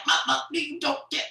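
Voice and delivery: high, loud mock shouting between son and mama; deaf accent and angry mama. Son stammers, mama yells back: high loud mock shouting. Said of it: "deaf accent".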